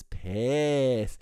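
"pissed" held long on the vowel; drawn-out emphasis. Real, not acted. Stressing the guest's anger over repeated fees, "pissed" drawn out. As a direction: stretch out "pissed" for comic weight.

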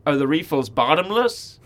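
put-on slow American accent with a questioning lift. Repeating himself to the puzzled cafe lady, slow American accent. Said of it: "trying my American accent out on the lady".

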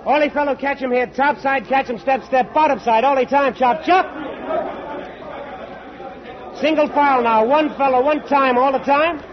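calling out orders. Acted. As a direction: bellow simple pidgin orders down the line. Mate shouting orders to coolies.

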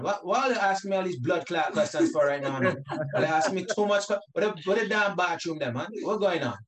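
loud, high, exasperated Caribbean rant; imitated flustered Caribbean newcomer at full volume; heavy Caribbean accent. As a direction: protest in thick Caribbean patois, comically worked up. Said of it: "with a heavy accent like how I had".

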